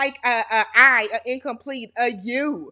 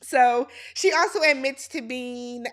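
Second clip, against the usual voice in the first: ordinary talk, nothing marked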